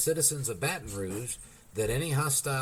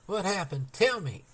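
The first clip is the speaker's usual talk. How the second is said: stormed demand; 7 semitones higher than usual. A narrator's reading. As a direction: demand it impatiently. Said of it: "Jenny stormed".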